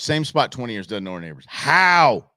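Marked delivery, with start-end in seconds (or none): 1.5-2.3 loud, baffled outburst; incredulous. Real, not acted. Host hears of strangers after twenty years, loud incredulity. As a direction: demand an explanation in disbelief.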